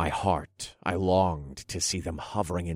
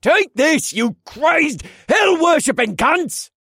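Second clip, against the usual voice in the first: furious shout; 10 dB louder, slower than usual. Acted. Guard throws dagger at witches.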